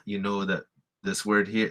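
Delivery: ordinary talk, nothing marked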